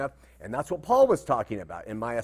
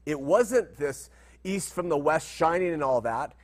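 ordinary talk, nothing marked

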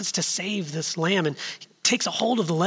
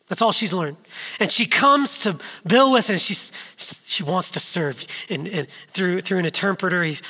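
normal talking voice

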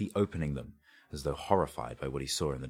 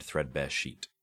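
usual speaking voice

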